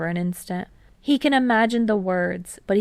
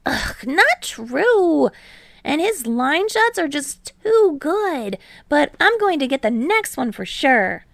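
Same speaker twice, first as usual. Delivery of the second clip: put-on higher, louder boyish teammate; Hinata, eager; 8 semitones higher, 4 dB louder than usual. A narrator's reading. Hinata bragging to Kei about deflections, higher, louder and eager. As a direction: bounce up, brag a bit.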